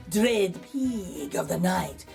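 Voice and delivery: usual speaking voice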